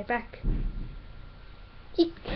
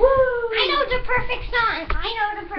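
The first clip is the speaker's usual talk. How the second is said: excited; 10 semitones higher, 6 dB louder than usual. Real, not acted. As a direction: sing it out with excitement. Excited about music.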